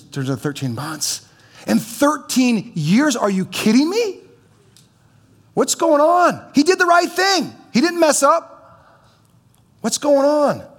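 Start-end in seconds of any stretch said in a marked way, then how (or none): 1.7-10.7 much higher and louder, disbelieving; exasperated outcry. Acted. Joseph after thirteen years crying out to God, high and incredulous. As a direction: throw the question at heaven, high and loud.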